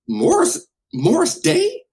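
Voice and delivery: high, loud, disbelieving question; acted-out astonishment; incredulous. Acts out his own disbelief.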